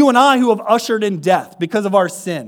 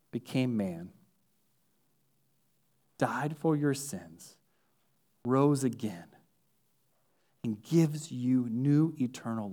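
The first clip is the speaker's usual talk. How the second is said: hushed, low pleading appeal to faith; soft altar call; 7 semitones lower, 12 dB quieter than usual. Acted. Preacher softly asks the congregation if they truly believe, hushed. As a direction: draw them in quietly, almost confiding.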